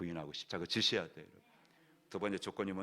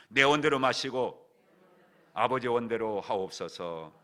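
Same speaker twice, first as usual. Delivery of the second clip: solemn prayer quote; 2 semitones higher, 10 dB louder, slower than usual. Real, not acted. Quoting Jesus' prayer emphatically.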